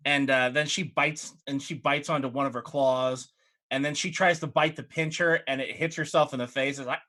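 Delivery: ordinary talk, nothing marked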